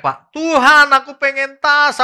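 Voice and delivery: high, loud, slow hammed-up begging cry to God; mock wailing plea. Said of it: "Lalu saya teriak-teriak".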